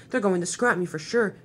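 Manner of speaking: plain reading voice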